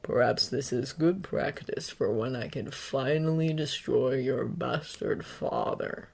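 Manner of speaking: low, slick menacing voice; sinister villain voice. A narrator's reading. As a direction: purr it like a killer. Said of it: "Stain's voice is as slick as oil".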